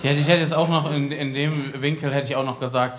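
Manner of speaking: ordinary talk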